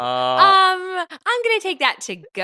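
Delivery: high, prissy diner; customer voice. Pretend. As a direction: order it to go, prim. Joins restaurant bit.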